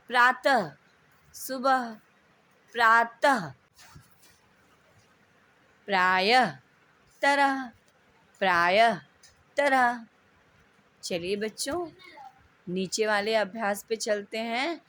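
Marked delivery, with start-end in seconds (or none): 0.1-10.1 प्रातः and प्रायः with a faint half h, सुबह and तरह with a full h, louder; contrast drill, louder. Shown as a demonstration. Teacher drilling the class loudly on visarga against h. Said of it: "उच्चारण में अंतर का अभ्यास करें".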